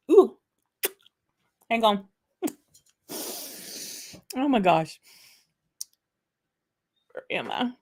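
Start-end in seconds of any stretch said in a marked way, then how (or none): none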